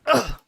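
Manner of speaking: loud, high exasperated "ugh"; disgusted groan. Acted. Student groaning at his bad test mark, exasperated. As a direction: blurt a frustrated groan. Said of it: "I hang my head in resignation, cursing".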